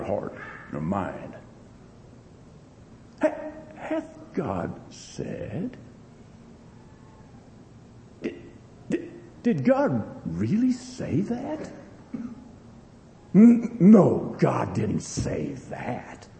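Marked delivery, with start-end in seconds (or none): 3.2-5.8 slow, hushed, insinuating serpent voice; drawn-out tempting whisper of the devil; sly serpent. Acted. Preacher voicing the serpent tempting Eve, slow and hushed. 8.2-11.7 slow, softer, questioning serpent voice; sly serpent. Acted. Preacher voicing the devil planting doubt, slow and soft. 13.3-16.3 sly serpent. Acted. Devil tempting Eve.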